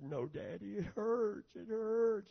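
lightly voiced hurting boy, rising on the hurt; a touch of a child's whimpered plea; mild imitated sick child. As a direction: retell a son's pained complaint. Retold sick son in pain.

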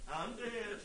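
tiny, high, faint possum voice; comic high, soft animal voice; possum playing dead. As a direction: squeak it weakly, pretending to be dead. Flesh mimicked as fake-dead possum.

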